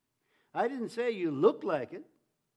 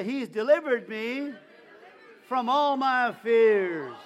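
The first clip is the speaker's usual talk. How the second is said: louder, slower, full-force declaration with "all" drawn out; say it like you mean it; 3 semitones higher, 10 dB louder, slower than usual. Shown as a demonstration. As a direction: belt it out with total conviction. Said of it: "Say it like you mean it".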